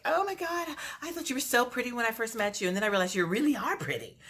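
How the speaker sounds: high, quick gushing imitation; mock gushing. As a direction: gush like a fake-sweet castmate. Castmates gushing, quoted.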